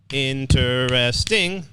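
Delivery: interesting, slow, each syllable separate; syllables spelled out. Shown as a demonstration. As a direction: draw out interesting to count its syllables. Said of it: "That has four".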